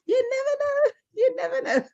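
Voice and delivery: high, giggly falsetto through laughter; laughing squeal. Real, not acted. Teasing her guest about having Scottish blood, high laughing squeal.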